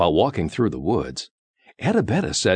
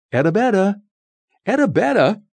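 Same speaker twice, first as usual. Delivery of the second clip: high, loud searching call; 5 dB louder than usual. A narrator's reading. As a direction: call for a missing friend. Kids calling for missing friend.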